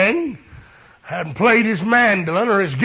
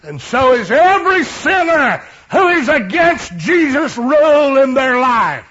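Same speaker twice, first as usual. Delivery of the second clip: loud, high preacher's shout; full-force preaching; 6 semitones higher, 6 dB louder than usual. Acted. Preacher to congregation, loud high preaching shout. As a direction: preach it out to the whole church.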